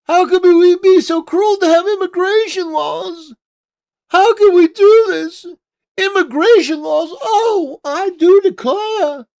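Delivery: hammed-up fainting-couch wail of I do declare; mocking a woman pleading against immigration laws in a shrill falsetto; mock Southern belle.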